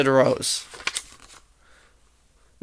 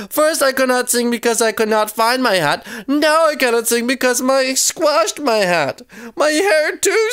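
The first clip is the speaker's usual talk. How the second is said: high-pitched storybook wail; upset complaint; 8 semitones higher than usual. A narrator's reading. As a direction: whine about the ruined hat. Acted quarrel over squashed hat.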